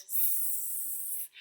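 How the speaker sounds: soft hiss; kettle hiss. Pretend.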